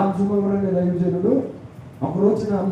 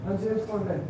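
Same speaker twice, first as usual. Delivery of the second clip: dismissive brush-off; 8 dB quieter than usual. Retold or imitated. Dismissive reply.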